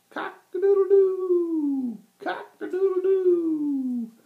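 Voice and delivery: very high, loud, slow crowing; drawn-out high cock-a-doodle-doo; rooster crow. A narrator's reading. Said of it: "said the rooster".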